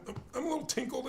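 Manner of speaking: quick, high-pitched mild admission; understated annoyance. Retold or imitated. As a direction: imitate someone only slightly miffed. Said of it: "radically different emotional responses".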